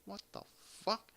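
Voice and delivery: hushed, halting disbelief; creeped out. Retold or imitated. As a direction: say it very softly, pausing before the last word. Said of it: "I just got creeped out".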